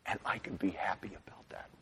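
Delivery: soft unvoiced whisper of quiet joy. Pastor voicing a child's private thought, whispered. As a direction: breathe it like a secret thought. Inner thought, tender close.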